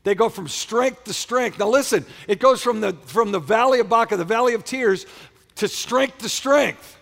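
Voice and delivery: loud, high-pitched preacher; rousing pulpit call to the congregation; fired-up preaching. Real, not acted. Preaches at full force.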